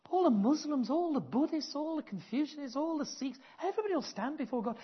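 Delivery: high, insistent preaching build; rising preaching. Real, not acted. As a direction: hammer each group, then rush the last. Listing religions before judgment.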